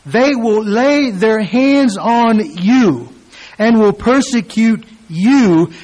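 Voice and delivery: "they will lay their hands on you" slow, declaimed and loud; slow loud preaching. Shown as a demonstration. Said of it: "repeated reading of verse".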